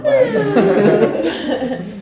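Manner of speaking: cracking up at the image; laughing. Real, not acted. Describing God with figures popping out, laughing.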